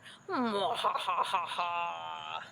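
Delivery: wicked witch's ha-ha-ha cackle; evil cackle. Acted. Sea witch cackling as she plots, evil cackle.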